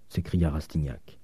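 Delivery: ordinary delivery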